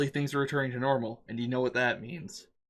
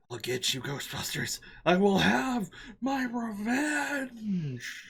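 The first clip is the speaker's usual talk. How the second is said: seething shrieking spirit; 9 semitones higher, much slower than usual. Acted. Fleeing demon shouts revenge.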